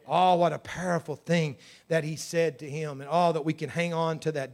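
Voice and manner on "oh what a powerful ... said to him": normal talking voice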